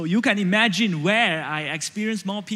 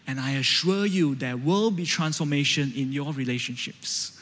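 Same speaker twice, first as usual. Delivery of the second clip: ordinary delivery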